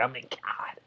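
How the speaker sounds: breathy whispered exasperation. Real, not acted. Vlogger whispering his disbelief at the plan. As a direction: drop to a whisper, fed up.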